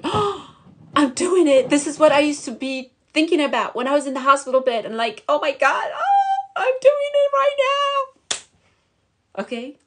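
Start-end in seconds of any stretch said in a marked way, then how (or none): 0.0-4.8 high, excited retelling of her own joy, fast at the end; overjoyed disbelief. Retold or imitated. Retells joyful moment on Stairmaster. 5.3-8.4 very high, stretched out cry of joy; ecstatic disbelief. Retold or imitated. Retells joyful moment on Stairmaster.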